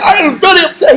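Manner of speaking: high-pitched imitation of an angry caller; mock upset. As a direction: play the caller getting worked up. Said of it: "He gets more angry".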